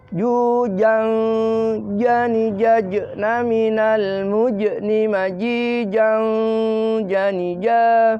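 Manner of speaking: slow chanted "Jujang" with held NG; jim pronunciation drill. Shown as a demonstration. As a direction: chant "jani ja" with long vowels and firm J. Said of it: "Nah, itu huruf jim". (melantunkan "Jujang" pelan)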